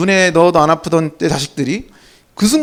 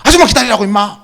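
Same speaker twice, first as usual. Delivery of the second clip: mock fatherly outburst at a nagging child; angry father; 3 semitones higher than usual. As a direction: imitate a dad losing his temper. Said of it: "갑자기 아빠가 화를 내시는 거예요".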